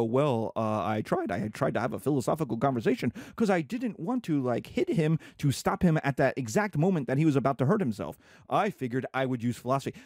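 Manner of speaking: low, lame excuse-making; sheepish uncle. Acted. Uncle excusing himself to his brother, low sheepish voice. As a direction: explain yourself low and haltingly, then hurry on. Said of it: "And I go".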